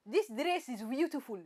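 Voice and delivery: lilting Hausa accent, "beautiful" starting with a v. Shown as a demonstration. Host retrying the challenge phrase, Hausa accent. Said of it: "should I say Hausa".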